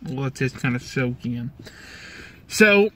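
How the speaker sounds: talking around a lip bulge; tobacco in lip. Real, not acted. Seen 3: Lower lip bulges and twists while talking; he spits into a bottle earlier.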